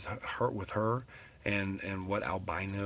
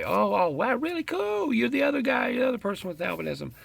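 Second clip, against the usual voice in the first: very high, loud, gushing fake excitement; mock amazement; 7 dB louder than usual. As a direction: gush as if wildly impressed, hammed-up. Mocking the reaction supposedly expected.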